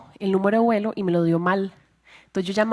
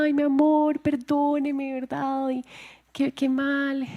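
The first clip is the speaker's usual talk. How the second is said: retold tearful pleading; 5 semitones higher, slower than usual. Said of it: "Y yo llorando".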